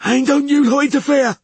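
very high, loud wheeze; wheezy threat. A narrator's reading. Another sailor warning off witcher's party, high wheeze. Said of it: "wheezed another".

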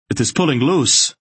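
high, loud panicked yell; shrill yell. A narrator's reading. Silk yells in panic as the turret tears loose. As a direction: yell the warning as the rock gives. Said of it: "Silk yelled, his voice becoming shrill".

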